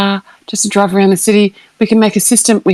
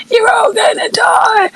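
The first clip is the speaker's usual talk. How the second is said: mock scream; 11 semitones higher, slower than usual. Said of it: "being like".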